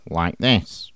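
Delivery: talking through the nose; low, quiet nasal drawl. Shown as a demonstration. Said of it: "so your voice becomes very nasal".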